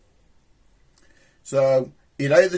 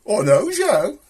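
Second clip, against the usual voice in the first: mock fan voice; 6 semitones higher than usual. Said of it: "folks have been coming up to me and says".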